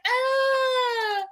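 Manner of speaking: drawn-out high-pitched "ah"; high, held cry, played for laughs; mock frustrated wail. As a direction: let out a hammed-up wail of protest. Acts out frustration at Kenny's refusal.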